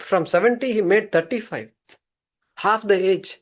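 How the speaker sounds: ordinary talk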